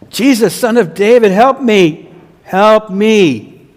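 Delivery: loud, high desperate plea; crying out. Acted. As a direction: call out begging for help. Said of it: "he cries out".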